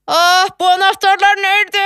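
loud, high wail of frustration; exasperated cry. Acted. Woman who cannot find her keys, exasperated. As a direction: cry out at the end of your rope. Loses patience searching for keys.

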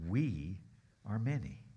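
low, soft and creepy; creepy whisper-low. Acted. Preacher repeating the demon's words to the church, low and creepy. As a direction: make it sound ominous. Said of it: "Is that creepy or what?".

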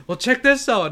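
high, loud showman grandpa quote; voiced grandfather. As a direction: announce it high, like grandpa showing off. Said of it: "And he goes".

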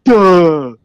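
high, loud dopey "duh"; exaggerated dopey "duh"; mocking "duh". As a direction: play it dumb and mocking. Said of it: "that's not what I sound like".

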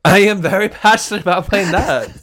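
high, loud laughing insistence. Real, not acted. Host laughingly defends her nut talk, high and loud. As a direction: proclaim it through a laugh.

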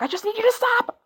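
put-on high, strained mom yell at the kids. Mother yelling at her fighting sons, high strained yell. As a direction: shriek it like a fed-up mom. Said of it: "I kind of screamed and was like".

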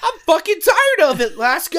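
high, loud fed-up whine; exasperated. Real, not acted. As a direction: vent it at full volume. Complains about being sick.

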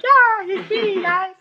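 very high-pitched mock player's voice; mock midfielder. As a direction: squeak it like a silly footballer. Mocks midfielder standing around the field.

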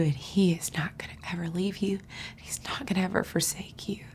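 hushed promise to someone hurting; soft whisper. Real, not acted. Comforting the listener, whispered.

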